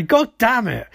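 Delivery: hammed-up frustration, punched out; mock exasperated outburst. [name] finds out Joe knows, mock exasperated outburst.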